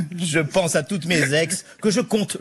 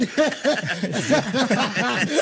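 laughing; 7 semitones higher than usual. Real, not acted. Laughs at own joke.

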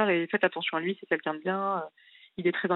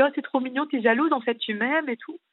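higher, cooing mock of her husband; mocking sweet husband; 7 semitones higher than usual. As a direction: tease her as jealous, sugary. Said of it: "Et après, il dit". (mari imité, mielleux et plus aigu)

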